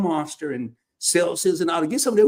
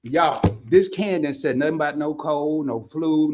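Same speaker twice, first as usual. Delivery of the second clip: ordinary talk, nothing marked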